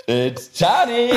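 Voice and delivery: drawn-out, high mock villain call; film villain call. Host quoting a film villain, slow call. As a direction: stretch it out in a hammed-up call.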